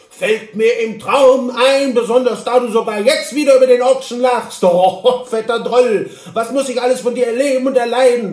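high, loud, pompous little man in a huff; indignant Hobble Frank. Acted. Hobble Frank answers from his horse, loud and offended. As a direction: declaim your offended dignity loudly.

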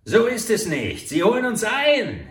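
high, loud, frightened; oil prince shrieking that they're being caught; panicked yell. A narrator's reading. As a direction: scream it over the hoofbeats. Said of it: "schrie der Ölprinz".

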